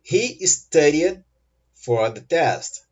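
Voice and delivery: very slow, pause before the last phrase; very slow model sentence. Shown as a demonstration. Teacher models sentence, first take slower. (frase-modelo bem lenta e pausada)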